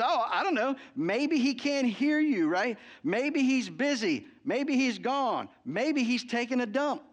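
slow, high, sarcastic drawl; sarcastic jeering at the silent god; mocking taunt. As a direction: draw out each "maybe" to needle them. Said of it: "He starts trash talking 'em".

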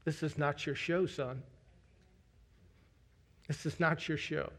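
soft, low voice of God speaking to a son; gentle inner voice. Retold or imitated. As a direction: say it softly, like a quiet rebuke that comforts. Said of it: "I heard in my spirit".

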